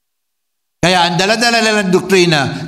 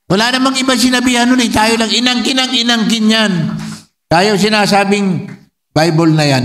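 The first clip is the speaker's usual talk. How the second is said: usual speaking voice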